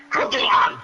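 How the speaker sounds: "Croc du High" barked in a hard German accent; loud, harsh German-accented "Croc du High". Shown as a demonstration. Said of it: "in German accent".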